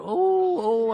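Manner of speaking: high, loud imitated "oh"; a woman's helpless ooh-ing, hammed up; mock whooping "oh". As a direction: let out long high mock "oh"s. Retold lady audience member's outburst.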